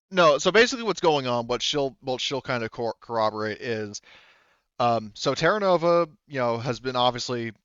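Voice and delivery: normal talking voice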